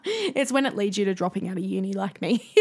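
words broken by her own laughter; laughing at dropping out of uni; laughing through it. Real, not acted. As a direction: chuckle at yourself while saying it.

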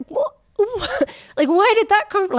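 high, loud, disbelieving with a laughing cry; incredulous. Retold or imitated. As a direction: act out shocked disbelief, half laughing. Retold disbelief at offensive question.

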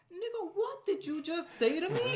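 high, slow, loud indignant challenge; angry guy confronting. Retold or imitated. Retelling a guy offended at being called mate, high and slow. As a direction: demand it like you're offended. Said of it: "And he goes".

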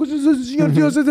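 high, hammed-up plea to God; mock pleading prayer. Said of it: "han är kristen". (överdriven, hög bön till Gud)

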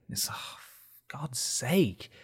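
hushed, exasperated sigh of words; exasperated whisper. Real, not acted. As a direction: mutter it in despair. Frustrated aside at game.

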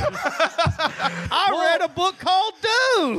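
slow canned talking toy; pull string toy. Pretend. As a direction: play a pull-string doll. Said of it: "pulled the string in the back of [name] like Woody".

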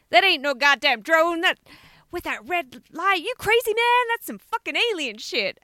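imitating rowdy Americans at a party, in a broad American accent; American partygoer accent. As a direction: ham up a rowdy American accent insisting it's aliens. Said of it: "they talk like this the whole way through".

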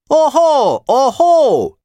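high, loud heckling; shrill, jeering shout; shouted objection. A narrator's reading. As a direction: cry out in protest from the benches. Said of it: "kiáltotta az ellenpárt".

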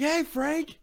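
high-pitched eager hail, not shouted; excited greeting. Retold or imitated. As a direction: call out to someone you admire. Retold calling out to an idol.

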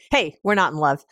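ordinary talk, nothing marked

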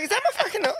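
hammed-up outraged woman shrieking; shrill mock outraged woman. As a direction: mimic her screeching at the counter. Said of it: "She's like".

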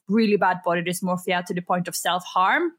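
ordinary delivery

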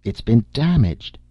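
agitated cry. A narrator's reading. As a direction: stress the damage in distress. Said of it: "he cried with obvious agitation".

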